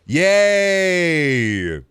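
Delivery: high, loud mock yay; sarcastic cheer. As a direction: fake celebration, dripping irony. Sarcastic cheer about shop skins.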